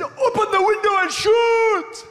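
high, shouted accented preacher impression; hammed-up evangelist's accented shout; imitating Reinhard. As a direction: cry it out with a long, ringing last word. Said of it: "How did he say it?".

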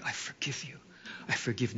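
whispered under the breath; hushed whispered forgiveness. Retold or imitated. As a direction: breathe the words as a whispered vow. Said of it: "I was proclaiming the F word".

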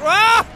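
short loud high yell. Real, not acted. Player to game as a crash hits, yell. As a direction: let out a sudden high shout.

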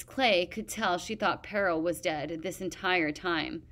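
usual speaking voice

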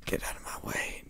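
breathy whisper to the block. Real, not acted. Gamer whispering at something in his way. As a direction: hush it under your breath.

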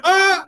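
short, very high yelp of alarm; startled yelp. Retold or imitated. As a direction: yelp like you just missed the shot. Reliving missing the fire shot.

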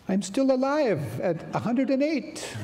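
delighted. Retold or imitated. Quoting her joyful boast.

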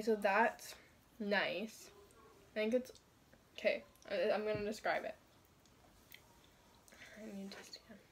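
muffled behind fabric; through a cloth bandana. Real, not acted. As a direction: cloth over mouth and nose. Seen 1: Tie-dye bandana covers whole face including mouth, often held with hand.

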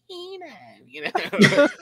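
a quick mimic of the boss calling a nickname; impression of Kevin. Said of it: "I was just like".

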